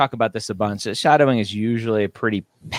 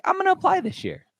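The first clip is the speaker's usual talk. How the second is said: high-pitched imitation of an impulsive student; mock eager student; 3 dB quieter than usual. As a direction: lightly squeal it like a keen applicant. Mimics impulsive students deciding to apply.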